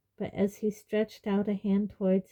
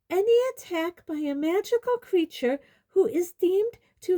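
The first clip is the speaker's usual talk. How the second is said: high, loud officious woman; officious lawgiver; 11 semitones higher, 4 dB louder than usual. A narrator's reading. Umbridge quoting law at centaurs, high officious voice. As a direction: recite the rule in a high, imperious voice.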